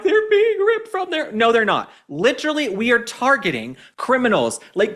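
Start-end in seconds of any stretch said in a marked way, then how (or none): 0.0-1.3 high, slow, whiny mock sob; mock tearful plea. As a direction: parody her crying into the phone. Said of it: "starts crying".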